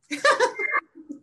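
a high real laugh bursting out; laughing at her own blunt advice. Real, not acted. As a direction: laugh at your own cheek.

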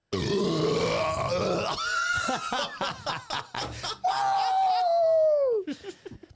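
big high shouting cackle. Real, not acted. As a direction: burst out in a howling laugh. Friend chokes on soda.

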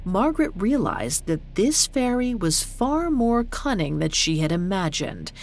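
usual speaking voice

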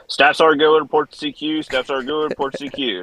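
imitating the barracks intercom; mock loudspeaker call. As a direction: call it out like a base announcement. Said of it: "like, you know, big announcement".

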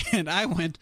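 ordinary delivery

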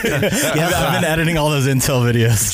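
talking through real laughter; laughing. Real, not acted. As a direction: laugh while you explain. Laughing after failed R attempt.

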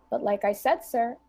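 ordinary talk

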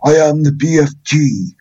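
high, loud go at a Cornish giant; bad Cornish accent, announced grandly; attempted Cornish. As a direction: have a stab at Cornish. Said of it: "I can't do Cornish".

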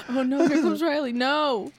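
normal talking voice

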